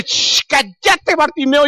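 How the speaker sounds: long shush, then high-pitched scolding; mock-exasperated, high-voiced hush; crowd hushing. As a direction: silence a nuisance with a drawn-out shh. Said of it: "Y viene la gente".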